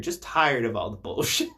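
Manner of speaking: said through a laugh. Real, not acted. Laughing about how tired Jesus is of it all. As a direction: let the laugh break through the words.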